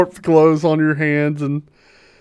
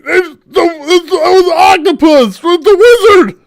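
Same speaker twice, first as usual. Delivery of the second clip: loud high rushed "it was an octopus"; panicked shout; 12 semitones higher, 8 dB louder than usual. Acted. Loud high panicked shout. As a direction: Harvick panicking after the killing. Harvick defending himself in panic.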